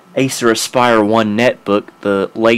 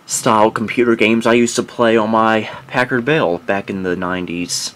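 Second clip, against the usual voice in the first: ordinary talk, nothing marked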